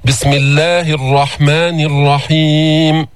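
ordinary delivery

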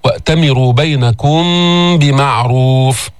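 nasal hold at the end of "بينكم"; labial ikhfa. Shown as a demonstration. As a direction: hum the م of "بينكم" into "بمعروف". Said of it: "بغنة عند الباء".

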